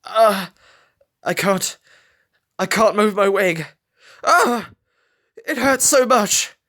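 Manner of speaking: high, strained voice of pain; anguished. A narrator's reading. Injured falcon telling Scarlet his wing hurts, high anguished voice. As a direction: gasp it through the hurt. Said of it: "His voice clearly pronounced his anguish".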